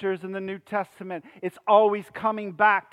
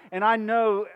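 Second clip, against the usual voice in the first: normal talking voice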